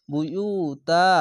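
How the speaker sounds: বুয়ুতা with the ইউ stretched one alif; elongated vowel. Shown as a demonstration. Teacher modelling the stretched vowel, slowly recited. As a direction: draw out the middle vowel of বুয়ুতা. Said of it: "এক আলিফ টেনে পড়বে".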